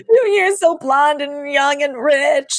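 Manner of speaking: high, slow, drawn-out wail; hammed-up whine of self-pity; mock tearful begging. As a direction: plead pitifully, overacting it. Imitating the begging scene again.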